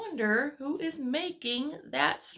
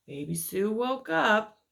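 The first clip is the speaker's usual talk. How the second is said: story narration; 4 dB louder than usual. A narrator's reading. Reads picture book aloud.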